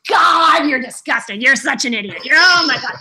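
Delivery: hammed-up disgusted friend, loud outbursts; Nick. Imitating best friend appalled by naked interview idea, hammed-up disgust. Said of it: "Nick was like".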